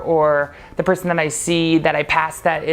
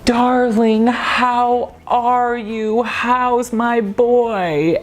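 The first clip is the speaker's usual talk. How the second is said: higher, very slow, doting darling; imitating Eve sweetly; 6 semitones higher, much slower than usual. Eve turning to her favorite customer, slow and doting. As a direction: melt into sugary sweetness. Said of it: "on a dime, she would turn to me".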